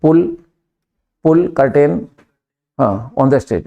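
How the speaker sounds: spelled out slowly for the class; slow dictation. Shown as a demonstration. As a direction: say each word slowly so students follow. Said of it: "देखो".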